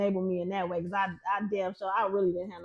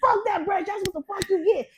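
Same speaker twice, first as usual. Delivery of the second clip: high-pitched angry cussing; raised, heated mock yell; changed voice, retold; 9 semitones higher, 5 dB louder than usual. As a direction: reenact yelling back in a fight. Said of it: "My whole voice was different".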